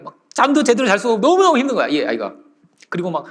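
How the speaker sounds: ordinary talk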